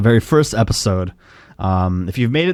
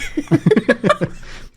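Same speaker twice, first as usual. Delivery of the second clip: goblin laugh; 12 semitones higher than usual. Acted. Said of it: "the goblin".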